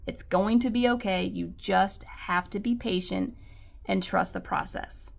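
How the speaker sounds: ordinary talk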